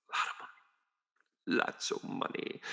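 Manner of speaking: breathy whispered aside, voice dropped away. Shown as a demonstration. Hushing viewers about hidden Swiss bank money, whispered. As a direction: lean in and whisper it like a secret.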